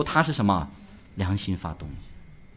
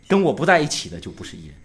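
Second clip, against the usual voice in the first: ordinary talk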